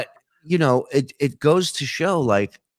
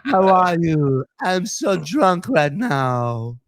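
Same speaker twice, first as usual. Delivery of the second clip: slow, lazy crooner croon; [name] impression; 4 semitones higher, 4 dB louder, slower than usual. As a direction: drunk lounge singer slur. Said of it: "It's [name]".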